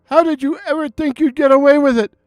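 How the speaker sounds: a wronged man crying out, rising to a shout; trembling emotional outcry. Acted. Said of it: "in a voice trembling with emotion, cried out".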